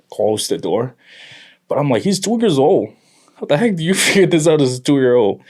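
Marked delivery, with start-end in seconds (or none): 2.0-5.4 high, loud incredulous dad; pitched-up, laughing astonishment; amused disbelief. Retold or imitated. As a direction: marvel loudly with a laugh breaking in. Retold amazement at his toddler.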